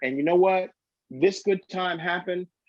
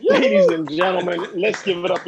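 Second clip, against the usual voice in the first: announcer call; 2 semitones higher, 5 dB louder than usual. Real, not acted. Host announcing to crowd.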